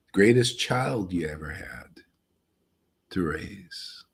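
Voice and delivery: soft breathy whisper; whispered aside. Real, not acted. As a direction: drop to a hush, almost to yourself. Intimate aside about raising children.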